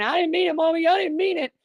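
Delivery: put-on high-pitched tearful child, pleading; bawling little boy. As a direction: mimic a preschooler crying sorry to his mom. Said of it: "he just busted out bawling, saying".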